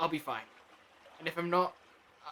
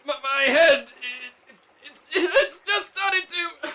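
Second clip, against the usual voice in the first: much higher, louder, halting and slow; panicked pain; 10 semitones higher, 12 dB louder, slower than usual. Acted. Two telling the Doctor his head is splitting in panic. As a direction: gasp it out as the pain hits.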